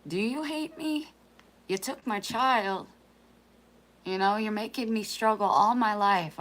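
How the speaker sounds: a daughter voicing her mother's anguished prayer, loud and pained; desperate plea to God. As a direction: voice her mother's raw despair, pleading upward. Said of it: "And then you're like".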